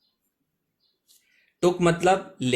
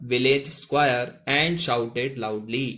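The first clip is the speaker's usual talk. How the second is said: very slow, word by word English; slow model reading; much slower than usual. Shown as a demonstration. Teacher to Hindi learners, reading the next line very slowly. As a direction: spell out the sentence slowly for beginners. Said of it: "एक साथ देखिएगा".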